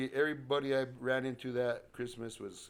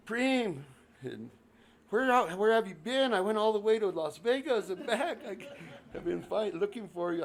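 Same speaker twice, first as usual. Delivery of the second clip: higher, louder worried scolding; exasperated scold; 10 semitones higher, 5 dB louder than usual. Retold or imitated. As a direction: scold him for vanishing. Cousin scolding, upset.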